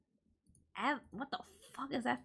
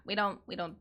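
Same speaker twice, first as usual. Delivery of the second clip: normal talking voice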